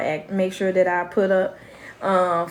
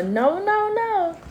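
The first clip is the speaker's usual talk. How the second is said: put-on high child's firm refusal; high little-kid no, no, no; imitating grandson; 9 semitones higher, much slower than usual. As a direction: voice the toddler saying no. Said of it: "you gonna get a".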